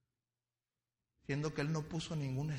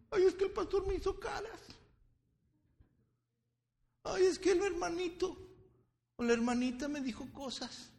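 high, louder complaining mock voice; offended churchgoer; 6 dB louder than usual. Pastor mocking a member offended by brethren, high whine. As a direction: whine like someone easily offended.

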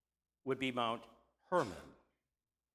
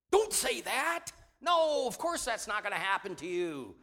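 high, pleading voice for the disciples; preacher voicing the disciples as a panicked, higher plea; protesting disciples; 7 semitones higher, 5 dB louder than usual. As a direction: squeal it like an alarmed friend begging him to stop.

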